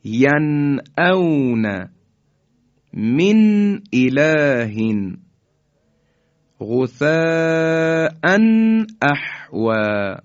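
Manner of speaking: slow recitation, nun sounded clearly with no hold; Quranic recitation, clear izhar. Shown as a demonstration. Teacher reciting the correct examples, slow clear izhar. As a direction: recite slowly and pronounce the nun cleanly. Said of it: "به این شکل".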